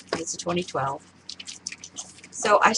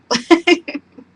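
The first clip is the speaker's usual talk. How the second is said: laughing; 9 semitones higher, 10 dB louder than usual. Real, not acted. Laughs at own wording.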